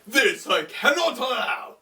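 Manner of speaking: hammed-up villain's outburst; Anubis. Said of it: "Anubis is like".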